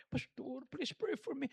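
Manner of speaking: a sick man asking quietly for prayer; weak plea. Retold or imitated. Sick pastor begging for prayer from bed, soft and weak.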